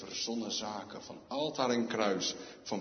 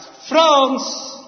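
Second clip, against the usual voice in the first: sudden loud call of a name, much higher; keihard roepen; 15 dB louder than usual. Pretend. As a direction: shout the name right in his ear. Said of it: "ik ga dan in je oor roepen, keihard". (luid in het oor geroepen)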